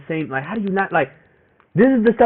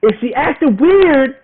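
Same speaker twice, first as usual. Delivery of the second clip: heated warning; 9 semitones higher, 5 dB louder than usual. Real, not acted. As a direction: hammer the advice home, louder each line. Ranting warning to other guys.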